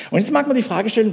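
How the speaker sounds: ordinary talk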